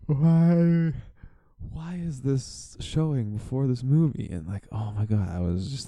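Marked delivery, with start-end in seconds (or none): 0.1-4.3 high-pitched mock-weepy whine; retold tearful protest. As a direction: plead it high, imitating yourself on the verge of tears. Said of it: "tearing up".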